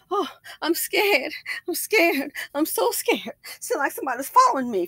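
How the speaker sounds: high, whimpering fake fear; fake scared old lady. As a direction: whine it like a frightened old woman. Imitating scared old woman at door.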